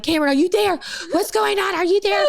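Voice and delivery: high-pitched, urgent questions; imitated worried mom. Mom on speakerphone calls daughter, imitated worry. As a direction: imitate a mom calling anxiously down the phone.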